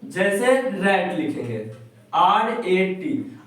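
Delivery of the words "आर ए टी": slow spelling. Shown as a demonstration. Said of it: "teacher spells word slowly".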